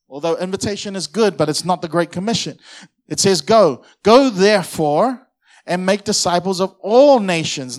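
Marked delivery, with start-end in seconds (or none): 3.4-7.8 loud, slow preached command; proclaiming. Acted. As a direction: declare the commission. Preaching at full force.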